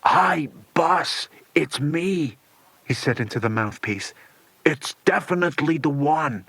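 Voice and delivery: a lightly voiced dim henchman; henchman on the phone. Igor phoning his boss Slade, lightly voiced henchman.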